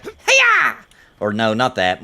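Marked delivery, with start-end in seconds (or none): 0.0-0.8 squeaky cartoon hiya, way up high; jump sound yell. Proposed jump sound for the game, squeaky high hiya. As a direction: pop it out like a game jump effect. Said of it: "change your jump sound to be like".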